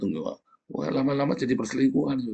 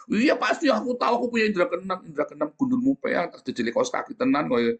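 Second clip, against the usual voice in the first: mocking know-it-all; 7 semitones higher than usual. Mocking a self-proclaimed psychic.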